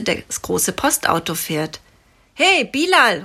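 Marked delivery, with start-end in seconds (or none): 2.3-3.3 higher call to a friend. Acted. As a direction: call out happily to the driver. (fröhlich gerufen)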